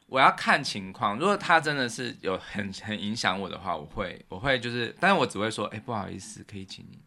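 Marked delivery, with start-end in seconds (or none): 5.7-7.0 softly polite, almost trailing off; polite quiet request. Retold or imitated. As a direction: ask politely under the breath. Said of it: "很有礼貌的这样说". (轻声客气地请求)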